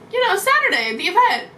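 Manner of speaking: a hint of a cheery fan; matter-of-fact friends. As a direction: state it like it's obvious. Friends state the obvious.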